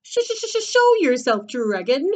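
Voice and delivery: high, stammering challenge; squeaky knight. Acted. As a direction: stutter a squeaky, nervous dare. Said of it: "squeaked a thin, skinny knight".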